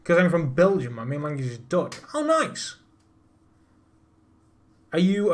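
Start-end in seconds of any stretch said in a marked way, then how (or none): 2.1-2.8 sudden high bright pitch leap; excited "oh nice". Real, not acted. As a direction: jump up in pitch, genuinely pleased. Reacting to chat message.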